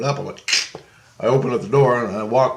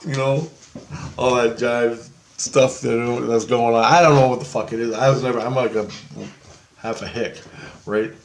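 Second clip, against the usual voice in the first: ordinary delivery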